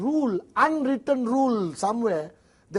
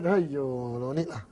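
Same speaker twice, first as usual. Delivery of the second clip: dismissive auntie; 8 semitones lower, 6 dB quieter, slower than usual. Retold or imitated.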